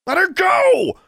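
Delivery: high, loud frantic scream. Acted. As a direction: scream it at the monster. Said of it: "Brian screamed".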